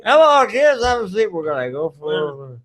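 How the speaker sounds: bus driver voice. Pretend.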